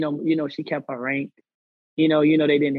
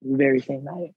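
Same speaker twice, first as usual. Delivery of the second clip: somber and slow; 3 semitones lower, slower than usual. Real, not acted. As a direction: let the loss weigh on each word. Telling of a suicide.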